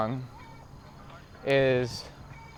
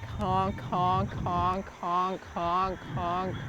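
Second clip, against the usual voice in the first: mock honking; 6 semitones higher, 3 dB quieter, much slower than usual. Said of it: "He was like".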